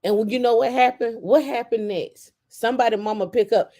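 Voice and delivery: ordinary delivery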